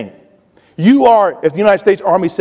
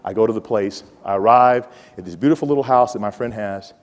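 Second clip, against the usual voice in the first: usual speaking voice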